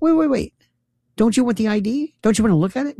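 high-pitched insistent questioning; baffled protest. Retold or imitated. Voter urging poll worker to check ID, high baffled protest. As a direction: plead high, in disbelief. Retold protest over unchecked ID.

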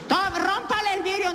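high mock voice of an old lady; lady offering grinder. Lady handing the wife a grinder, high mock voice. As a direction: hand it over like a helpful busybody. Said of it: "llegó una señora".

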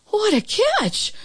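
put-on very high, drawn-out girl's amazement; amazed exclamation. A narrator's reading. Girl amazed by the super's catch, high slow exclamation. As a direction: exclaim it slowly, pitched right up. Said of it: "was all she could say".

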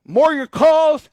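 big booming radio tease; radio announcer. Acted. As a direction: sell the next segment.

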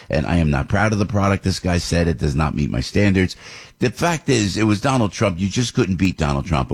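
normal talking voice

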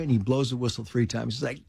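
usual speaking voice

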